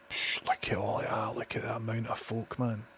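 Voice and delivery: hushed, taking in the crowd; awed whisper. Real, not acted. Showing viewers the crowded street, awed whisper.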